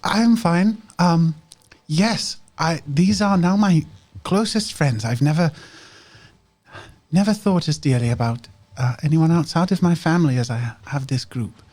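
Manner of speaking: put-on young girl earnestly telling the group they are her closest friends; Medela's voice. High girlish voice, sincere. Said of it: "She's like".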